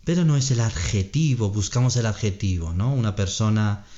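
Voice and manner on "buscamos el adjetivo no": usual speaking voice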